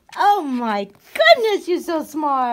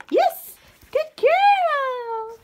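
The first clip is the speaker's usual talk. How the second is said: squeaky praising a dog; 10 semitones higher, much slower than usual. Real, not acted.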